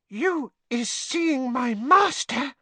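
breathless, slow, high squeak; Winky. Acted. House-elf hearing news of her master, breathless squeak. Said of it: "said Winky breathlessly".

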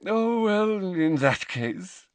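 high, pleased, drawn-out agreement; smiling. A narrator's reading. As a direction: give in sweetly, with a smile. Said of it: "with a smile".

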